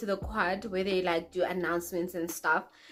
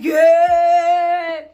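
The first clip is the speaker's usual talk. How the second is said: a loud, higher mock cheer from his friends; imitating a hype yell; 8 semitones higher, 14 dB louder than usual. Friends cheering the boy on in the quad, mock yell. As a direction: shout it like the boys egging him on.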